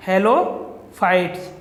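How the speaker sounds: drawn out, for noting down; halophytes stretched long for dictation; slow repeated term. Shown as a demonstration. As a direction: say halophytes slowly, each syllable clear. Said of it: "इन्हें कहते हैं halophytes".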